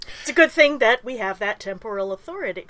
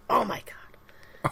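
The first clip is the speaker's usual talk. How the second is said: whispering; 5 dB quieter, much slower than usual. Real, not acted. Aside whispered during stumble.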